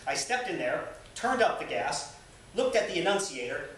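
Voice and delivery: reading a memoir aloud with dry humor; lively, amused reading voice; story narration. Acted. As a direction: tell it like a fireside storyteller. Reads Twain's autobiography aloud.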